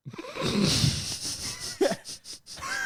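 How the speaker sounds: high, shrieking laugh. Real, not acted. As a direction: let out a big cackle. Big laugh at the joke.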